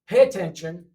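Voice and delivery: "Pay attention." said loud as a classroom order; teacher command. Shown as a demonstration. Teacher ordering the class to listen, loud. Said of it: "This is like you're talking to a class".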